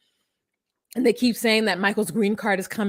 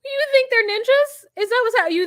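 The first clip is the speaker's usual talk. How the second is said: incredulous; 14 semitones higher, 4 dB louder than usual. Real, not acted. Incredulous retort.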